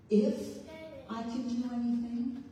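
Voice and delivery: a questioning stress on "If". Shown as a demonstration. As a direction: lean hard on the word "If". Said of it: "where is the emphasis".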